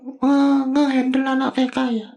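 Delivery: high sidekick voice asking Kak Bagas about teaching; Bobi voice. Acted. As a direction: push the pitch way up like a cartoon sidekick. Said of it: "Bobi tanya lagi deh".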